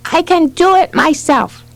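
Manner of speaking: a hint of insistent toddler; lightly voiced toddler. A narrator's reading. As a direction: say it like an independent small child. Narrator voices toddler's first sentence.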